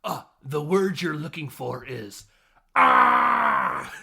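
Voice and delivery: movie villain line building to a loud yell; Danny DeVito. Retold or imitated. Host imitating the villain's first line, ending in a shout. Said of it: "his first line is".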